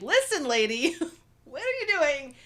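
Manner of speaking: mock-indignant scolding with a laugh; mock confrontation. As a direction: playfully re-enact getting in someone's face. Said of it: "get into an old lady's face".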